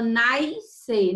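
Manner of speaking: "nascer" said as "naiscer", with an added i; intrusive I. Retold or imitated. Teacher imitating how many people mispronounce nascer, added i sound. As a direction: slip an i glide into "nascer": "nais-cer". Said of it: "Tem muita gente que fala".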